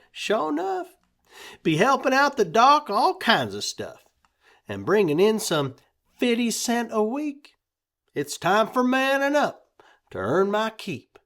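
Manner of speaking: put-on high, bright boyish pride; proud boy. A narrator's reading. Boy telling the family about his new job, high and proud.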